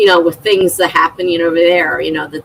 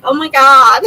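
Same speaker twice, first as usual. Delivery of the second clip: mock dismayed exclamation, higher and louder; exasperated oh my God; 5 semitones higher, 5 dB louder than usual. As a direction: throw up your hands at the chat bot going berserk. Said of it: "And I'm like".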